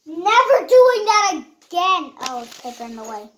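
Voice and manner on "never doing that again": emphatic. Real, not acted. Loud slow vow.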